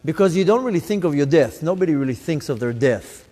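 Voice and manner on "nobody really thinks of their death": ordinary delivery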